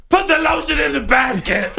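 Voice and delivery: creepy movie quote. Pretend. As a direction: bark the line like a captor. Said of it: "I would put".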